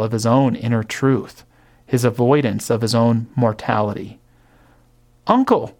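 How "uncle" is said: "Uncle" pleaded on a high rise; an appeal to a hard old man; pleading. A narrator's reading. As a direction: beg him, voice jumping up on "Uncle". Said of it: "pleaded the nephew".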